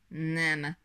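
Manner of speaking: mild growl, loud single word; a hint of a snarling dragon's loud no; growled refusal. A narrator's reading. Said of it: "mordult rá a sárkány". (enyhén rámordulva, hangosan)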